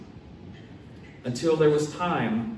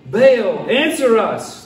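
loud, raised cry to a god; shouted plea; 7 semitones higher, 9 dB louder, much slower than usual. Acted. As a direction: call out loudly for an answer. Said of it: "they shouted".

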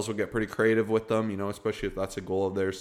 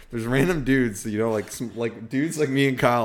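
ordinary delivery

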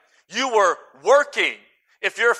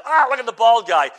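mocking kid; 4 semitones higher, 6 dB louder than usual. Preacher voices a mocking kid.

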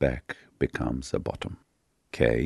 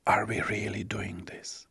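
soft, breath-only whispered question; 6 semitones higher, 7 dB quieter than usual. A narrator's reading. The question on both lovers' minds, whispered. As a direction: whisper it like a private thought.

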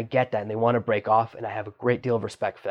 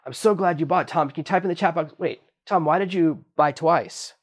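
higher, bright mock-excited host calling out; 6 semitones higher than usual. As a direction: play the thrilled webinar host, pitched up. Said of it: "you can say".

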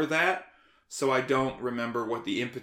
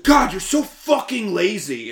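loud, high imitation of a fed-up adult; exasperated scolding; 7 semitones higher, 8 dB louder than usual. Guest imitating adults blaming an undiagnosed kid, loud exasperated scolding. Voicing everyone scolding the child.

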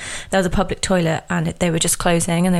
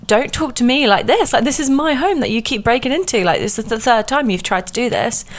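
indignant retort; 6 semitones higher than usual. Retold or imitated. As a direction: throw her words right back at her. Speaker retorts to intruder.

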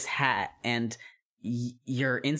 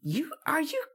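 indignant witch; 8 semitones higher than usual. Acted. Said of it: "witch indignant".

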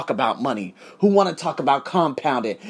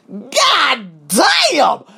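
loud, high, stretched god damn; a lovestruck cry of god damn; smitten exclamation; 8 semitones higher, 5 dB louder than usual. Retold or imitated. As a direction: blurt it out at a beautiful woman. Smitten as she walks in.

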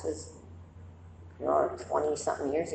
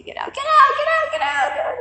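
much higher, louder shrieking get out; screaming at the movie; 8 dB louder than usual. Retold or imitated. Yelling at the characters in The Shining, high shriek. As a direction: shriek it at the screen like a panicked viewer. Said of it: "that's what was so funny about the movie".

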